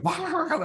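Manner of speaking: a sudden high wah to scatter the hens, laughing; high mock shooing cry. Boy shooing chickens off the eggs, high laughing wah. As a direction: squeal it high to scare the chickens. Scaring chickens off their nests.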